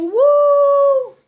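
high loud whoop; excited whoop. Real, not acted. Gamer whooping at a big catch. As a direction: cheer it out. Cheering while playing.

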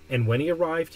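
usual speaking voice

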